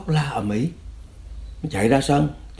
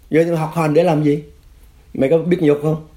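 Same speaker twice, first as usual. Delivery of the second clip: imitated interrogator, raised pitch and louder; badgering interrogator; 7 semitones higher, 4 dB louder than usual. Cadre berating his prisoner, mock angry. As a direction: fire the insult as a demanding question.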